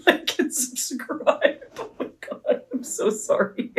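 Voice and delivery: words squeezed out through laughter; breaking up laughing, barely voiced; helpless giggling. Real, not acted. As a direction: try to sign off while cracking up. Cracking up at her own sign-off.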